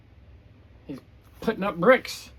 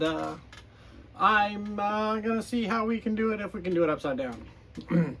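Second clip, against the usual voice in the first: ordinary delivery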